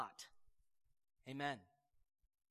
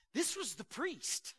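ordinary talk, nothing marked

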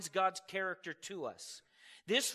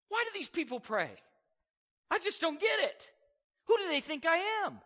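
much higher, louder bewildered voice; flustered confused God; 8 semitones higher, 4 dB louder than usual. Pretend. Preacher mocks idea of befuddled God, high flustered. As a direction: play God utterly baffled. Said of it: "Is he up there going".

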